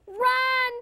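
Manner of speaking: loud, high shout; yell. Acted. Elijah yells to his pets to run. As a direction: yell it to make them run. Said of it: "yelled Elijah".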